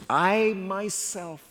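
slow, each word weighted: I myself; stress on I myself. Shown as a demonstration. Preacher reading God's own words, slow emphatic stress. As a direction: lean hard on "I myself". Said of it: "emphatically appointing the king".

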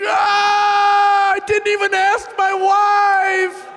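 high, loud anguished wail; weeping cry. Retold or imitated. Preacher reliving weeping at the offering bucket, anguished wail. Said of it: "I start weeping".

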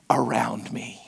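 whispered aside; soft whispered end of a confession. Real, not acted. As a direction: breathe it out unvoiced. Sermon confession trailing to whisper.

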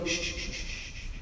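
breathy hushing, very soft; calm the storm like a child; whispered shushing. Retold or imitated. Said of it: "when he goes".

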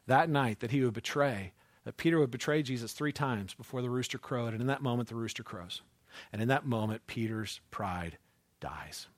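a sinking, solemn hush; hushed solemn telling. Acted. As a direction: drop low and soft, slowing to the end. Solemn quiet storytelling.

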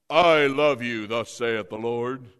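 loud, slow divine proclamation; thundering thus saith the Lord; mock voice of God. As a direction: boom it from the clouds. God's voice from a great cloud.

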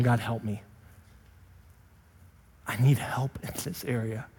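soft, hushed plea; quiet prayer. Pretend. Believer prays to God for help, hushed. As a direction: pray it softly, humbly.

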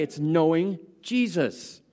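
ordinary talk, nothing marked

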